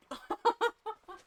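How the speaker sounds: a burst of high giggling; high giggle. Real, not acted. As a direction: laugh it off, a bit embarrassed. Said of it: "I can't stop yawning".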